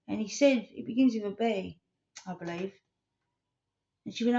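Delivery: normal talking voice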